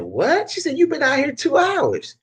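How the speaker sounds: high-pitched mock shock; retold astonishment. Customer amazed at price for two hours' work, retold astonishment.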